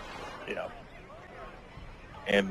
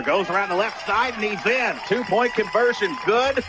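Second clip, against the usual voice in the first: excited call; 7 semitones higher than usual. Real, not acted. Two-point conversion call.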